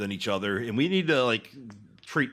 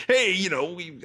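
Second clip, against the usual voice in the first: put-on New Jersey accent; 5 semitones higher than usual. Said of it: "New Jersey accent".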